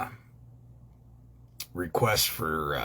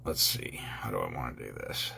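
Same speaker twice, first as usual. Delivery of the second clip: muttering to himself; 9 semitones lower, 7 dB quieter than usual. Real, not acted. Thinking aloud to himself.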